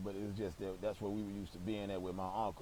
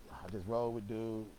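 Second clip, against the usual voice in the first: ordinary talk, nothing marked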